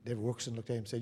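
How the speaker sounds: normal talking voice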